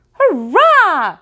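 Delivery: put-on high, loud little-kid hurrah; excited cheer. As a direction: burst out cheering. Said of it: "cried the quintuplets".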